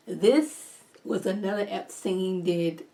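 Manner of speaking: normal talking voice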